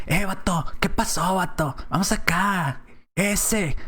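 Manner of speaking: mock street-kid greeting with slang tones; slang street talk. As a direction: show off the street tone to the youths. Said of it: "haciendo tonitos".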